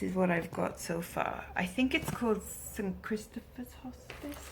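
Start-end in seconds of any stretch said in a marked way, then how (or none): 2.4-4.5 soft unvoiced whisper. Real, not acted. Naming the charity to viewers in a whisper. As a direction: murmur the name under your breath.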